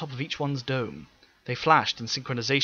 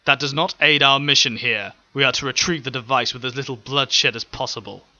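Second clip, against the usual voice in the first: louder, plain firm officer voice; calm, simple and firm; firm general; 7 dB louder than usual. Acted. General Ryuk correcting the Daleks, simple firm reply. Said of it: "replied simply but firmly".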